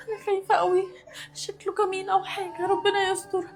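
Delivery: soft, scared talking to herself; frightened murmur. Acted. Delivery girl alone with her fear, soft scared murmur. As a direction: murmur your fears under your breath.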